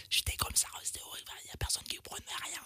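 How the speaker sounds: voiceless whisper with no voice left; broken voice. Retold or imitated. Presenter imitating his lost voice on air, whispered. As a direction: rasp it out as if the voice is gone. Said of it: "quand je vous dis cassée".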